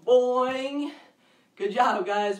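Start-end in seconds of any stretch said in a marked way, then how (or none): 0.1-0.9 loud springy boing, rising; kangaroo boing. Shown as a demonstration. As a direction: hop it out loud. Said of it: "Let me hear some kangaroo sounds".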